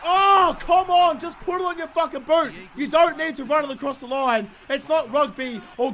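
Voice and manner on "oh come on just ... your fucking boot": pleading with useless players; exasperated groan. Real, not acted. Gamer begging his team to just kick it, exasperated wail. Frustrated at teammates' kicking.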